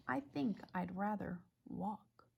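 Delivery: breathy whisper of a sulky horse; whispered thought. Acted. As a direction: whisper it to yourself.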